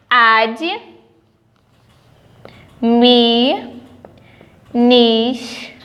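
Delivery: administrativo split very slowly as "ad-mi-nis-tra-ti-vo"; syllable-split demo. Shown as a demonstration. Teacher separating syllables for students, very slowly. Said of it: "eu separo".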